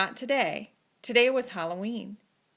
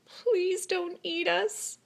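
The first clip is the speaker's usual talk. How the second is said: higher, slow pleading; frightened plea; 8 semitones higher, much slower than usual. A narrator's reading. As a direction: beg for mercy, drawn out. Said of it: "cried the dogs".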